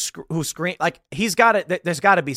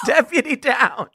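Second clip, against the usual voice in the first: higher, drawn-out mock distress call; mimicked radio alarm; 7 semitones higher, slower than usual. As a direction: lightly parody an officer reporting a casualty. Mimics sergeant's radio call, then laughs.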